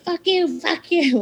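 high mock cursing drunk; high hammed-up fuck you; mock drunk swearing. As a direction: imitate her spitting curses, pitched high.

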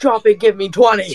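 barked high drill order; drill sergeant. Pretend. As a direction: shout it like a drill instructor.

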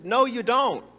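usual speaking voice

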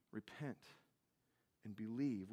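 quiet repeat. Shown as a demonstration. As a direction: let the words sink in softly. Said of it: "his first commands".

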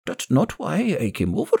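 put-on high, gravelly old woman contradicting; high-pitched, disapproving old crone. A narrator's reading. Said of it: "The old woman's gravelly voice".

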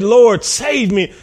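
high, loud, drawn-out plea; dramatised shout. Retold or imitated. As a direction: cry out for rescue, preacher's re-enactment. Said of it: "he shouted".

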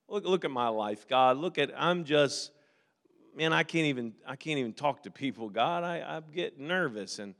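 small voice of a man who feels unworthy; timid Moses. Acted. Moses telling God he is too ordinary, soft and low.

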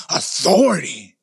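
low, drawn-out, growled disdain; resentful growl. Acted. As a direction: growl the word slowly, as if it offends you. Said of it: "something inside of you just growls".

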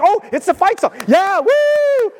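high whooping excitement, acted out; hammed-up thrilled fan, ending in a whoop; excited fan. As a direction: cheer as the team's song starts. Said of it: "gonna be so excited when the song comes on".

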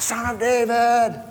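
high, slow pleading call; crying out. Retold or imitated. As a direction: stretch the cry high and slow, begging for mercy. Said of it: "He's crying out".

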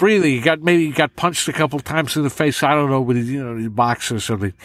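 breathing tough guy who boxed; Robert De Niro. Shown as a demonstration. Impressionist adding breath to the voice, tough-guy impression. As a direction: talk like an annoyed, punched-about New York tough. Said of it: "And he's breathing".

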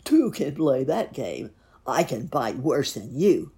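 loud, boastful storybook villain; lightly sneered retort; sneering wolf. A narrator's reading. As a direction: brag it forcefully as the wolf. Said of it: "sneered the wolf".

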